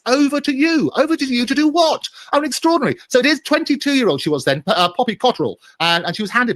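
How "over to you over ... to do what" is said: quoted with disbelief, pitched high; Over to you lifted high in outraged disbelief; incredulous quote. Retold or imitated. Commentator quoting Fergie's email in disbelief, pitched high. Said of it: "the text that she sent him is".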